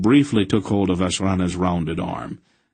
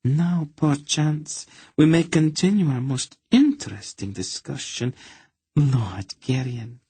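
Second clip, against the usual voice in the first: purring; 5 semitones higher than usual. A narrator's reading. Countess purrs at cornered Garion, seductively. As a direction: purr it like a huntress closing in. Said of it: "she purred at him".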